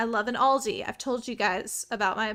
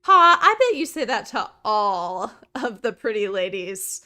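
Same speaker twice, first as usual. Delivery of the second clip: mock flirty; 7 dB louder than usual. Flirts back at game shopkeeper.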